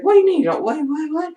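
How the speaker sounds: high, incredulous mock questioning; mock bafflement. Acted bafflement at mother's reply.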